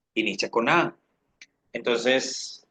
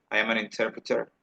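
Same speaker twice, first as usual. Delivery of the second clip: quicker, softer connected delivery; natural-speed repeat; 5 dB quieter, faster than usual. Shown as a demonstration. Teacher repeats the sentence for students at natural speed, quick and connected. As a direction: run the words together briskly.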